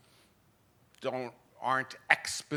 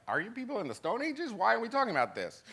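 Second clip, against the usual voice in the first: incredulous; 8 semitones higher, 4 dB quieter, faster than usual. Retold or imitated. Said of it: "people in Europe would be like".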